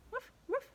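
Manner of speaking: high playful woof, woof; dog woof. Shown as a demonstration. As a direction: bark like a puppy for kids. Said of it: "What's the dog say?".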